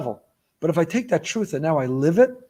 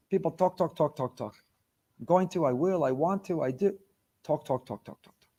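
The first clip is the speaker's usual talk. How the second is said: fading repetition; 7 dB quieter than usual. Shown as a demonstration. Mocking endless talk, fading.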